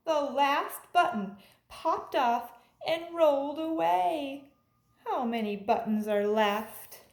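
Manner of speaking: put-on lively storybook read-aloud for kids; animated picture-book narration for a class; reading to children. A narrator's reading. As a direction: read it like a teacher at story time, bouncy and clear.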